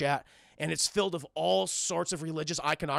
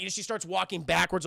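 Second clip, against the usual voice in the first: ordinary delivery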